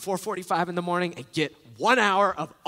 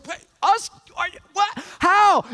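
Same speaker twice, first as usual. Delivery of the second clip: much higher, disbelieving stammer; mock shocked questions, louder on "How"; incredulous disciples; 11 semitones higher, 5 dB louder than usual. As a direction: play the disciples caught off guard. Disciples stunned by Jesus' command.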